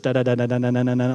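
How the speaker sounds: imitating stammered syllables on one pitch. Shown as a demonstration. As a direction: repeat a single syllable in a steady string. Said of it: "all they have is one syllable".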